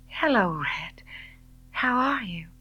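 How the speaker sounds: whispered, breathy greeting; nervous hushed hello to the man she chased; breathless whisper. A narrator's reading. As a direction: barely voice it, caught off guard. Breathless greeting after chasing him.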